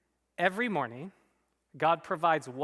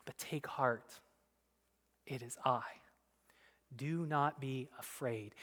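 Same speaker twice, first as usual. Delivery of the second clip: much softer, hushed "take heart"; tender reassurance; 3 semitones lower, 9 dB quieter than usual. Acted. Preacher voicing Jesus reassuring frightened disciples, softly. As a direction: speak it quietly like comfort, not a boast.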